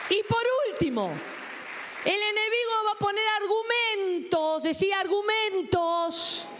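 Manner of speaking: high, rousing pulpit cry; preaching at full force. Real, not acted. (predicación a toda voz)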